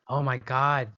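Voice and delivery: ordinary delivery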